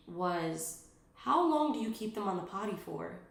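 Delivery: ordinary talk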